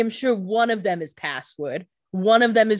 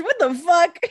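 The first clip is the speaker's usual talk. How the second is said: indignant what; 9 semitones higher, 4 dB louder than usual. Retold or imitated. Rebecca reacts in van.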